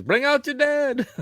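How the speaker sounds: high, slow sing-song call; mock town crier. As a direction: call it out like a plague cart crier. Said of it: "he goes".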